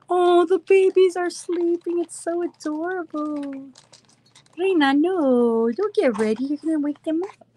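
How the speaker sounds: high, cooing talk to sleeping babies. Real, not acted. Streamer fussing over sleeping puppies, baby voice. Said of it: "I talk in that baby voice".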